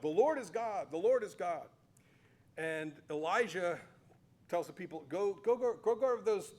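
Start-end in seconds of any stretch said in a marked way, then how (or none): 0.0-1.7 louder, raised acclamation of "Lord"; crying out. Retold or imitated. Teacher voicing the crowd falling on their faces after the fire, loud acclaim. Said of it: "and they cry out". 5.1-6.5 louder, higher, rapid-fire "go"s; urgent command. Retold or imitated. Elijah sending the people after the false prophets, urgent loud order.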